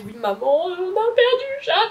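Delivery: high, loud mock-panicked confession to mum; mock guilty confession. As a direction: blurt it out like a guilty kid calling mum. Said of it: "j'avais pas les couilles de décrocher et de dire". (aveu paniqué pour rire à maman, aigu et fort)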